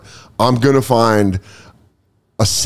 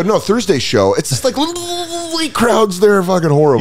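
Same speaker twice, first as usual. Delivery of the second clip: whiny comic voice; 10 semitones higher than usual. Retold or imitated. Imitating fellow comic, high.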